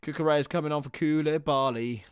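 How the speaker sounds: ordinary talk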